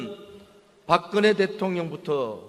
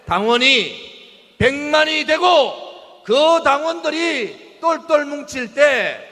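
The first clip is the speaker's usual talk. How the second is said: roaring rallying speech; 6 semitones higher, 4 dB louder, slower than usual. Real, not acted. Rally speaker rousing the crowd.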